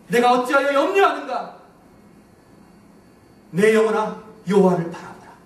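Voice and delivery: loud, high self-command, climbing; commanding himself. Retold or imitated. Pastor retelling how he ordered his soul, loud and rising. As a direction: rebuke your own fears out loud. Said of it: "저의 영혼에게 이렇게 말했어요".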